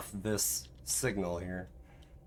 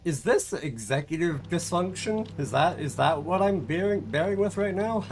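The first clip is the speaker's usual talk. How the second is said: higher, fretful mock panic; stressed student self; 6 semitones higher than usual. Streamer mocking herself panicking about a deadline. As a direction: play your college self spiralling over a deadline. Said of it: "I'm just like".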